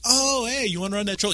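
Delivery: high, sing-out friend's voice; high-pitched retold invitation; imitated casual offer. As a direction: imitate a pal piping up out of the blue. Acts out friend's long-awaited offer.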